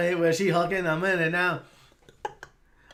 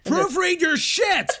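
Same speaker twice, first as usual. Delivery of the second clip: loud, slow shout at the screen; yelling; 11 semitones higher, 6 dB louder, slower than usual. Real, not acted. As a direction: bellow it out slowly.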